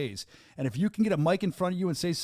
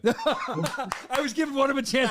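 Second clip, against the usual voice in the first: laughing, higher and louder; 7 semitones higher, 4 dB louder than usual. Real, not acted. Host laughing as he teases the booth guys, laughing. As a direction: get the words out through real laughter.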